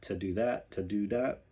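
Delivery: "to" with a full clear t and oo; crisp to. Shown as a demonstration. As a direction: drill "to do that" carefully. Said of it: "to, to, to every time".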